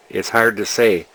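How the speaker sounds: normal talking voice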